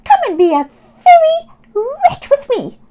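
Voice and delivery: high, loud squeaky rat greeting. Acted. Robert the rat meeting Oscar, high squeaky hello.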